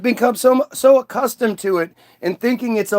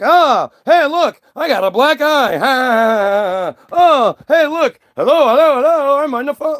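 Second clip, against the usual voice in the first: goofy joker; 5 semitones higher than usual. Retold or imitated. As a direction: ham up a dumb sitcom jokester. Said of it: "these guys that are like".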